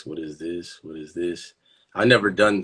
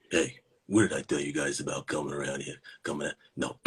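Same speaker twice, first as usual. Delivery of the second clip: low, softer character voice; gruff guy shooing kids; 7 semitones lower, 3 dB quieter, faster than usual. Acted. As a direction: shoo the kids off in a low put-on voice. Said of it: "Does this guy have this voice?".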